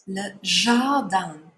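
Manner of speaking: Le jardin slowly, the -din as a nasal an; French nasal. Shown as a demonstration. Teacher slowly models jardin's nasal vowel. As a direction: stretch Le jardin, din sounding like dan. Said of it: "an I N in French sounds like an A N".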